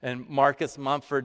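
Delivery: normal talking voice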